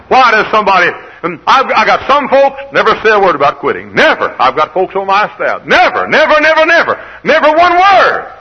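loud, high pulpit shout; impassioned preaching. Real, not acted. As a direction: build to a high, ringing shout. Preacher exclaims about loyal staff.

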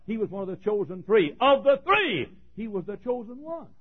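high, loud sermon cadence, pounding each phrase; fervent preaching. Acted. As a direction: preach it with rising fervour. Preacher's raised recital of Peter.

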